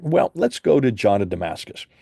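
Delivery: ordinary delivery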